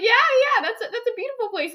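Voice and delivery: very high, put-on voice, loud at first; mock high-pitched reply. Girl retelling a confused exchange with friend, high-pitched imitation. Retold bewildered reply, mocking voice.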